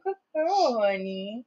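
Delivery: high, soft cooing praise; high-pitched pet-owner voice; pet talk. Real, not acted. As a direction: coo sweetly to a pet in a high voice.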